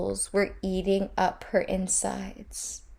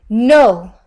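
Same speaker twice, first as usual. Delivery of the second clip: loud, higher desperate shout; crying out; 4 semitones higher, 15 dB louder than usual. A narrator's reading. Inanna shouting to save her friend from the demons. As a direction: refuse with everything you have. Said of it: "she cried".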